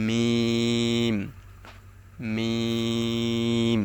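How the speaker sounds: long, hummed মিম on the dry part of both lips; slow, steady demonstration of মিম; dry-lip letter. Shown as a demonstration. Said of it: "শুকনা জায়গা হইতে".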